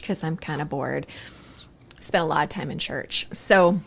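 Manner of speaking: normal talking voice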